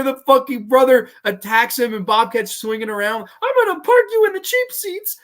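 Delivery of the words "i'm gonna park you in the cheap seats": high, loud strangled yell; Bobcat impression. Bobcat threatens the brother creature, high strangled yell. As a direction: yell it in a squeezed, cartoony high voice. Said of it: "I don't do a great Bobcat".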